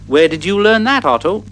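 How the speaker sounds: plain reading voice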